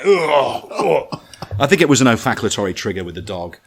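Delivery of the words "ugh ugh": higher, louder grunting like a straining dog; dog straining noises. Retold or imitated. The German Shepherd peeing in the helmet: higher, louder straining grunts. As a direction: grunt like a dog relieving itself. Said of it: "that's just going".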